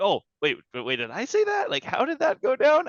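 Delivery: imitated disbelief, climbing to a high questioning pitch; mock incredulity. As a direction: act incredulous, as if caught off guard.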